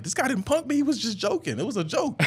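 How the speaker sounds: a hammed-up attempt to save face; mock defensive protest. To laughing teammates about the freshman, high defensive protest.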